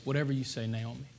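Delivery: soft, meek reply; a gentle, yielding answer to Naomi; humble and gentle. Acted. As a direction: say it softly, with humble grace. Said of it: "she just flows with humility and with grace".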